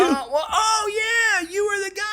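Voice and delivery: light up as you suddenly place someone, pitch jumping; sudden recognition. Retold or imitated. Show's creator recognising the stuntman, high-pitched surprise.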